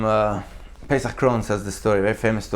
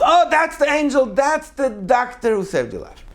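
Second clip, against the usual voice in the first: high excited call, then slowing; excited yell; 12 semitones higher than usual. Retold or imitated. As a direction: call out the news with joy. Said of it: "and he yells".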